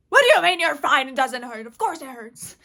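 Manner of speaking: mock-outraged mom, fast at first; incredulous yell. Mom to injured child who claims to be fine, incredulous yelling. Mom retorts in disbelief, retold.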